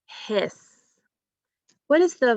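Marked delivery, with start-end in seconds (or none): 0.1-0.6 "hiss" set apart after a pause; "hiss" as a separate word part, ending on the s; isolated syllable. Shown as a demonstration. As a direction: say "hiss" alone as a careful syllable. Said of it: "So our first syllable was".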